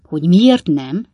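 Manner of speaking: slow, loud rhetorical echo; incredulous father, loud and deliberate; emphatic echo. Acted. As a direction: throw the question back, drawn out. Father echoes son's question emphatically.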